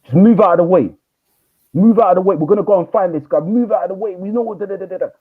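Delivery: high, loud retold shout of a grieving teen; angry shout. Grieving teen shouting at the teacher to move aside. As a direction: yell to push past.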